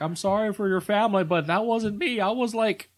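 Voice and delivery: high, sheepish apology; goofy Dale voice. Retold or imitated. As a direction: plead it like a meek hillbilly.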